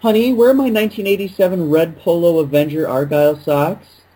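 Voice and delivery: hammed-up domestic query; mock sock-owning husband. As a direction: play a sock collector fretting over a lost pair. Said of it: "he looked in there, and he was like".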